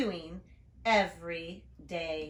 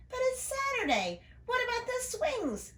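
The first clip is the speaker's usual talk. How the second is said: put-on much higher, whiny little boy; little brother calling. Little brother protesting as she leaves, much higher. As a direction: protest like a small kid. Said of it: "her brother called".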